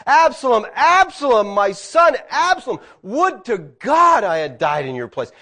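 high, loud grieving cry of a father; anguished cry. Retold or imitated. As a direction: cry out the son's name in grief. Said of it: "David cries out".

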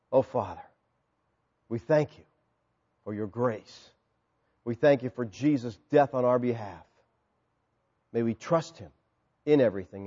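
soft, low prayer voice; hushed closing prayer. Acted. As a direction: pray quietly and humbly.

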